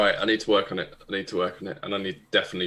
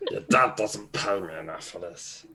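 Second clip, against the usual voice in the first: grumpy bodyguard; 3 semitones lower than usual. Acted. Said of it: "I don't know why I'm talking like this".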